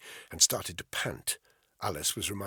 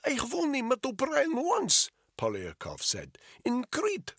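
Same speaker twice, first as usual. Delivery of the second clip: put-on thick Russian accent. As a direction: press the question in a heavy Russian accent. Polyakov voiced throughout.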